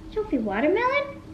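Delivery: high, soft coaxing question; pet talk. Real, not acted. Owner offering watermelon to Sophie, cooing. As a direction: sweetly offer the cat a treat.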